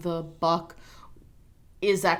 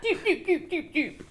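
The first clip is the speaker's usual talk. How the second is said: high-pitched playful doop noises; squeaky mock machine beeping; imitated gadget beeps; 11 semitones higher than usual. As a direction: make little electronic time-jump sounds by mouth. Imitating time travel gadget noises.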